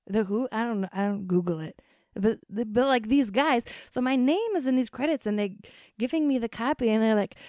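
usual speaking voice

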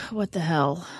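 normal talking voice

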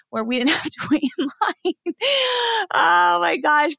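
high, giggly delivery; amused, laughter breaking into speech; laughing through words. Real, not acted. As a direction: crack up while getting the words out. Laughing through her retold boast.

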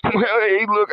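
ordinary talk

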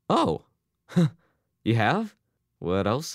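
high-pitched "oh" with a small laugh, then settling; surprised, empty laugh. A narrator's reading. Albert learning of his wife's interviews, surprised. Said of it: "with a surprised, empty laugh".

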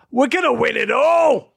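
loud, high rallying cry; exultant shout. Acted. Fan scratches his doubts about the cup, exultant shout. Fan abandons restraint, declares hope.

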